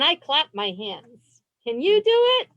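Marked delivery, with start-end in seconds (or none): 1.7-2.5 excited call. Acted. Calling to children.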